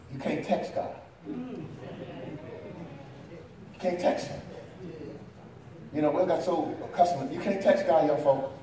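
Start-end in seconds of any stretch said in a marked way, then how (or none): 0.1-4.4 soft confiding whisper to the congregation; whispered aside. Real, not acted. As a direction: whisper it under the breath.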